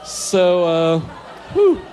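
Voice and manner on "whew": high, loud exhaled whew; relieved whew. Real, not acted. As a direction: let out a sharp, high whew. Recovers after embarrassing diary line.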